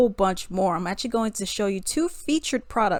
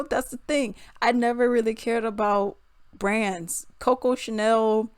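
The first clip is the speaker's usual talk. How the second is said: normal talking voice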